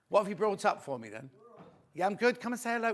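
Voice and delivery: ordinary talk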